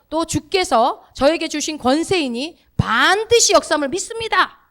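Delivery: slow, loud, rising declaration of faith; bold proclamation. Shown as a demonstration. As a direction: proclaim it with authority, building to a high finish. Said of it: "이렇게 여러분들이 말로".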